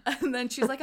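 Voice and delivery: normal talking voice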